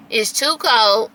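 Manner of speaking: ordinary talk, nothing marked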